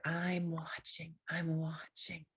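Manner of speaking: very soft, slow, drawn-out words; hushed reassurance. Acted. God telling the fearful he is watching, hushed reassurance. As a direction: drop right down and stretch it out gently.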